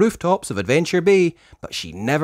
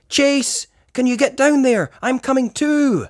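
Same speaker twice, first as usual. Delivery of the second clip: much higher, louder urgency; urgent call; 13 semitones higher, 4 dB louder than usual. Acted. Ryder calls Chase to the pool, urgent and high. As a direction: call down quickly.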